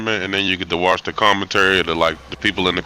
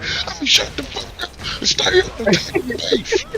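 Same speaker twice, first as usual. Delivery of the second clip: slow, much higher put-on heckler voice; crowd heckler; slower than usual. Retelling the fan who shouted, high mock heckler voice. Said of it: "Somebody was like".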